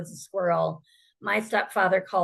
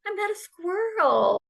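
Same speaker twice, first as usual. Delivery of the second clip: the penny drops, joyful and much higher; [name] retelling her breakthrough, excited and much higher; excited realization; 12 semitones higher than usual. Retold or imitated. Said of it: "And I was like".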